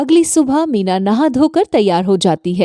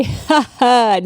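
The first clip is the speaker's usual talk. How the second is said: short taunting laugh from the mother-in-law; mocking laugh. Saas laughing at the thieving bahu, mocking. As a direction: laugh at her, caught red-handed.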